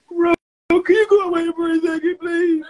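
strained high plea for someone to step away; pleading. Real, not acted. Streamer near tears, asks friend to leave.

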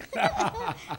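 sudden high giggle; high burst of laughter. Real, not acted. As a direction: laugh high and loud at herself. Laughs about Pooh.

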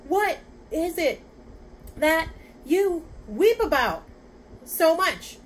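high, loud, broken into gasped bits; trying to get breath. A narrator's reading. Father asking the rhinoceros while tossed about, out of breath. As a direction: push out a few words at a time, pitched high. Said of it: "trying to get his breath".

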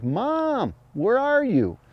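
high, drawn-out pleading fawn call; fawn distress cry. Retold or imitated. Hunter imitating a lost fawn crying for its mother. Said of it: "opens its mouth and yells like".

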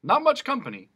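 high and loud mock cheer; teasing jailer. Jailer teases Milo about his cell, high and loud. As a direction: tease the prisoners.

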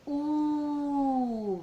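exaggerated rounded "U", lips pushed forward; drawn-out U. Shown as a demonstration. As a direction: show the mouth shape on "U". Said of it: "Mire mi boquita". ("U" exagerada con labios redondeados)

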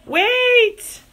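sudden high call after her; Papa calling Rachel back. Acted. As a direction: shout to stop her at the door. Papa calls after running Rachel.

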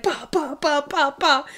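high, rapid-fire bah noises; punchy burst of mimicked whacks; imitated slamming. Imitating a sock monkey slammed on the table, mock banging noises. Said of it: "slamming it on the table".

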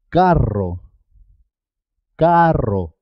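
carro with a rolled rr; double R. Shown as a demonstration. Teacher models carro for learners, rr rolled. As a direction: mark the trilled R in carro. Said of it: "siempre suena doble".

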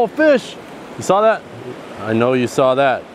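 normal talking voice

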